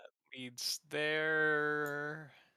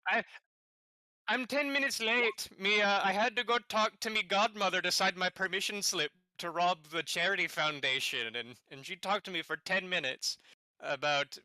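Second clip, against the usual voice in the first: put-on nightmare Irish accent; 3 semitones higher, 3 dB louder than usual. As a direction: ham up a terrible Irish accent. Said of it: "Nightmare Irish".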